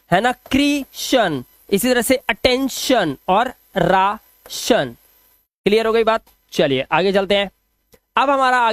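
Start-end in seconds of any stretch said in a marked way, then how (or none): 0.5-1.4 "क्रीशन" split slowly into two parts; pronunciation demo. Shown as a demonstration. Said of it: "दोनों का उच्चारण सिर्फ शन". 2.3-4.9 "अटेंशन", "राशन" slowly split before "शन"; pronunciation demo. Shown as a demonstration. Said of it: "दोनों का उच्चारण सिर्फ शन".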